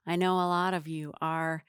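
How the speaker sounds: normal talking voice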